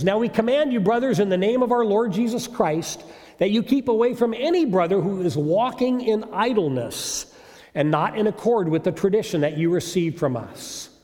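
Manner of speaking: firm, urgent scripture proclamation; scripture reading. Acted. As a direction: read the apostle's command with authority. Reads Paul's letter aloud.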